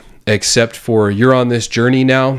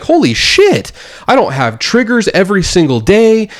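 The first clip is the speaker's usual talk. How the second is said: excited relief; 9 semitones higher than usual. Retold or imitated. Telling viewers his own breakthrough, high excited outburst. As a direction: burst out with joyful disbelief. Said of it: "I was like".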